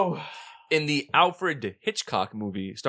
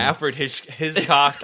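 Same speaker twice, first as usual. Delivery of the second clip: ordinary talk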